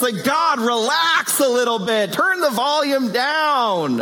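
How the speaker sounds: exasperated objector. Retold or imitated. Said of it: "Second objection is, it's excessive".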